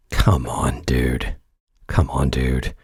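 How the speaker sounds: very low, weary dismissal; low-pitched, unimpressed plea; deadpan disbelief. Real, not acted. Dismissing the quoted claim.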